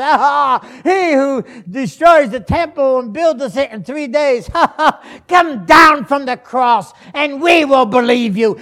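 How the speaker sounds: loud, high jeer with mock laughter; mocking crowd. People passing the cross taunt Jesus, mocking shout. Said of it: "mocked him, laughed".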